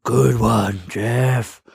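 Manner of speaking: tipsy "whisper" in a fully voiced tone; failed whisper at full volume. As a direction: confide it without lowering the volume at all. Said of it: "leaned over and whispered to him, like, at this volume".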